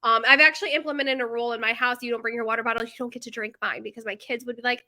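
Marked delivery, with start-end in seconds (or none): none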